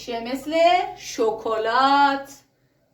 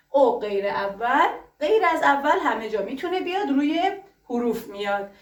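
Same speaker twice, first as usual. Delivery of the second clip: usual speaking voice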